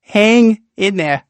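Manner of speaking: Rumple's joke. Acted. Rumple telling his joke in daydream, high and slow. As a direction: savour the punchline.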